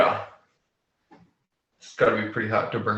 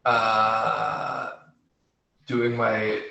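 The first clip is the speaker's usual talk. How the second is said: ordinary talk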